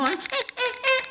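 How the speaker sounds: put-on high, whiny fussing toddler noises; imitating toddler whine. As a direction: mimic a fussy baby squirming. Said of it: "pinching her".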